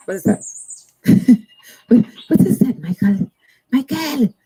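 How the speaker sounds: playful disbelief calling out Michael; laughing call to a viewer in chat; amused surprise. Real, not acted.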